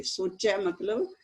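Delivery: ordinary delivery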